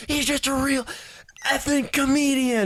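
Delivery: hammed-up shriek of outrage from afar; mock screaming. Woman yelling down the echoing hall, mock scream. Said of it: "we just hear her screaming".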